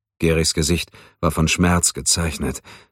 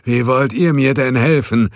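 higher, louder scornful voice; sneering and bitter; höhnisch; 7 semitones higher, 4 dB louder than usual. A narrator's reading. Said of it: "Gehrig lachte hämisch auf". (höhnisch und bitter)

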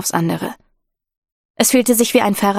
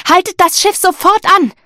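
urgent shout; 11 semitones higher, 5 dB louder than usual. A narrator's reading. Calling orders from the rigging.

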